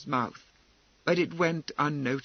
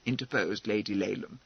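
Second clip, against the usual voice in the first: ordinary delivery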